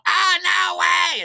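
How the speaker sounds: long, very high wailed yell; screaming refusal. Retold or imitated. Buyer out-screaming the fleeing dealers, long wild scream. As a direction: drag it out in a full scream. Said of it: "screaming it and screaming and screaming".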